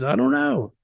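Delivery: high-pitched mock-clueless reply; retold cluelessness. Retold clueless answer from colleague.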